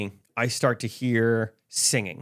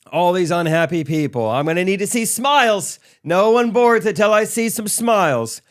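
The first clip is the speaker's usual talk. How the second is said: showy flight attendant; 7 semitones higher, 5 dB louder than usual. Retold or imitated. Flight attendant voiced in story.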